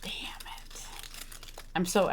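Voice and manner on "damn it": breathless whispered swear; tired whispered curse. Real, not acted. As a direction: hiss it under your breath.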